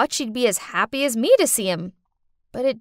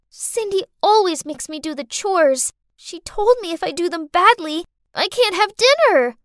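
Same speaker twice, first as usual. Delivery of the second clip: put-on complaining little girl; 7 semitones higher, 4 dB louder than usual. Girl lying to father.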